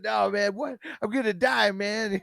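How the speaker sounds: high, loud, freaking-out wail; hammed-up terror of dying; mock panic. Retelling the acid scene, the stoner panics loudly.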